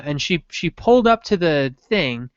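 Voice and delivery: ordinary delivery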